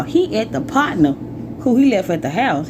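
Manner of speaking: ordinary talk, nothing marked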